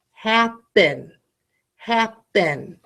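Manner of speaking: "happen" split into HAP and PEN; "happen" as two separate closed syllables; syllable demo. Shown as a demonstration. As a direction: say "happen" slowly with a gap between hap and pen. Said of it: "figure out how many syllables that is".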